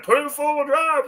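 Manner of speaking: high, far-off mock crowd yell; hammed-up crowd yell. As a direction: imitate the bystanders hollering. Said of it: "everybody's yelling at him".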